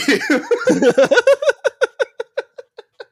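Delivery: very high, drawn-out laughter; high cackling laugh. Real, not acted. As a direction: cackle until out of air. Breaks up mid-rant.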